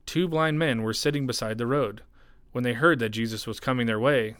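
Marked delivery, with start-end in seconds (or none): none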